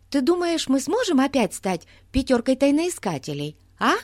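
put-on high, eager child voice; bubbly young girl, proud; excited little girl. A narrator's reading. Голос Бетси в сказке. (восторженная девочка)

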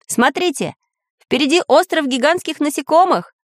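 high, lively call to look; excited pointing out. A narrator's reading. Samodelkin points out an island to friends, excited call. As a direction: draw everyone's attention, pitch well up. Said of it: "показал Самоделкин".

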